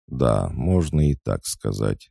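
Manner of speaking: usual speaking voice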